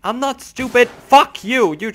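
very loud, high-pitched indignation; angry shout. Real, not acted. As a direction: yell back at the insult. Insulted by game characters, yells back.

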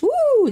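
high, playful "woo"; light, swooping high whoop. Pretend. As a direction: let out a high whoosh of a whoop. Moves herself aside on screen.

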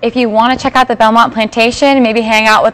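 loud host plug read; loud promo plug. Acted. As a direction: pitch it like a loud ad.

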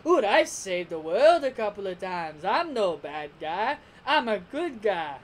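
hammed-up villain telling a lie; Frieza. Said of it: "should have just made up a lie saying".

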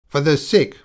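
high, loud mocking echo. A narrator's reading. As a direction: throw it back as a jeer. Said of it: "mocked Carlier".

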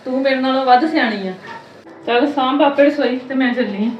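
loud, sarcastic telling-off; angry scolding. Acted. Mother-in-law berating her daughter-in-law, loud sarcastic anger. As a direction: snap back at her, loud and bitter.

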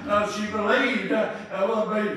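ordinary talk, nothing marked

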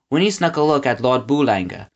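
plain reading voice

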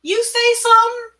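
very high, loud rising demand; high-pitched holler of a suspicious boss; loud challenge. A narrator's reading. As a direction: call it out sharply, daring an answer.

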